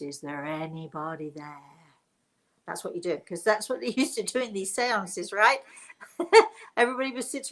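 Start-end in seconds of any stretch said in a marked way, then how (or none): 0.0-1.6 low, hushed medium calling to the spirits; eyes half closed, reach out to the other side; seance medium. Shown as a demonstration. Medium at a seance asking the spirits, low and hushed. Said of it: "you've got to say it in this tone of voice".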